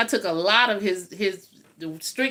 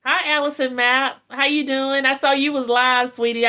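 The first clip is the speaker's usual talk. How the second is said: higher, louder cheerful hello to a viewer; bright greeting; 5 semitones higher, 4 dB louder than usual. Real, not acted. Greeting a live viewer by name, upbeat and higher. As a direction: perk up and greet her warmly.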